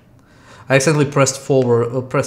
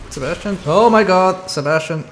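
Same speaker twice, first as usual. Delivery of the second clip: terrified gibbering; 4 semitones higher, 6 dB louder than usual. Real, not acted. Urging character to flee.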